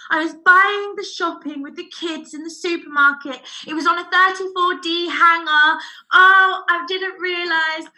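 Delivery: high, excuse-making retelling; flustered customer. Retold or imitated. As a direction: imitate a lady hurriedly explaining herself. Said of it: "she said".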